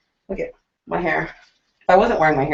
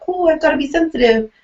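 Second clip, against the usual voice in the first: mock delicate caution; 9 semitones higher, faster than usual. Mocking someone treading on eggshells.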